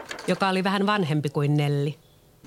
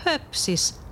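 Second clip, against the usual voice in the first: higher, brushing-off scoff; dismissive; 9 semitones higher than usual. Acted. Leena dismisses Peter never growing up, higher scoff. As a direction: wave the idea away. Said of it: "Leena sanoi".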